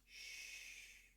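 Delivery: whispered shush; hushing. Acted. Impostor hushing Glamdry so River stays asleep, a whispered shush. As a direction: hush him softly. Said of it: "He goes".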